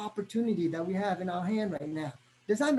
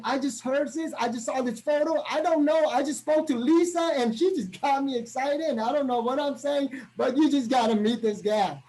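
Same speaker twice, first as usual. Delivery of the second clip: excited pitch. Shown as a demonstration. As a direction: gush about it like you can't contain yourself. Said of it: "I raise it up a notch".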